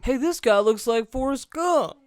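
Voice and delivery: loud, high-pitched corny announcement; hammed-up joke delivery. Host making the missed Gump joke, hammed-up and loud. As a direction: belt out the bad joke. Do you wanna make it now.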